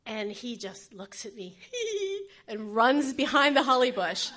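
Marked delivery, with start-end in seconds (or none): 1.6-2.4 high-pitched imitated kid squeal; child's gleeful giggle. Little boy looking back at his mother, mischievous giggle. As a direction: squeal like a naughty toddler.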